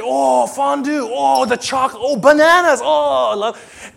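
high, loud, slow oohing; gushing delight. Retold or imitated. Beggar at the feast, gushing over every dish. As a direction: savour each treat out loud. Poor guests thrilled at feast.

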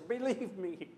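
usual speaking voice

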